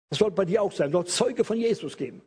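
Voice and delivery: usual speaking voice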